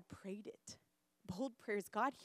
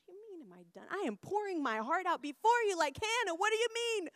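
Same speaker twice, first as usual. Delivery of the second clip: acted-out exasperated retort; indignant protest; 8 semitones higher, 5 dB louder than usual. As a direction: protest in hurt disbelief, building to the end. Acted indignant answer to Holy Spirit.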